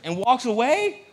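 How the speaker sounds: high, loud, drawn-out disbelief; incredulous mock outrage. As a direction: stretch it upward like an indignant question. Acting out his outrage.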